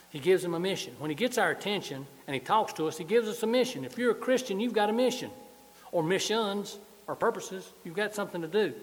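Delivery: rising, fervent preacher build; preaching. Acted. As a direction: build the sermon toward the peak. Sermon building to full force.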